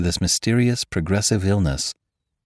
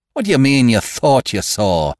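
snapped; 7 semitones higher, 7 dB louder than usual. A narrator's reading. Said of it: "snapped Mister Saunderson".

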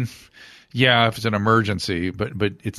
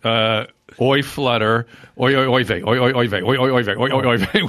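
loud repeated oi oi oi vey; rhythmic oi vey chant; 3 semitones higher, 4 dB louder than usual. Retold or imitated. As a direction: chant it like a fluttering heart. Mimicking flutter rhythm, joke.

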